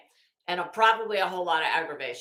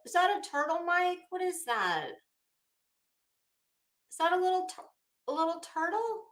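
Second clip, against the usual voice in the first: cooing; 8 semitones higher, faster than usual. Real, not acted. Streamer cooing at viewer Mike's turtle, high and sweet. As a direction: coo at it like a pet.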